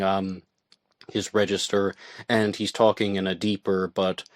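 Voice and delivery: ordinary talk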